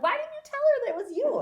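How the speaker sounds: high, loud imitation of astonished kids; mock incredulous sons. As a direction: playfully demand an explanation from mom. Sons' retold challenge to mother.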